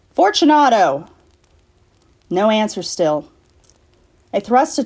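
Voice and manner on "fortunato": high, louder call into the dark; calling aloud. Acted. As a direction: call his name into the niche. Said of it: "I called again".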